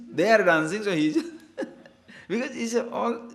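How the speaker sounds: amused teacher laughing mid-sentence. Real, not acted. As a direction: laugh as he explains the joke. Laughing at own joke.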